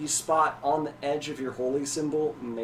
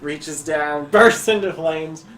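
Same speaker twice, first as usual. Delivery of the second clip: ordinary talk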